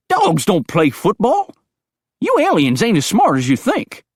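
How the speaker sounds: put-on high, loud, twangy old coot; crazy old hillbilly. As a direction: paranoid old mountain man, all fired up. Digger scoffs at aliens.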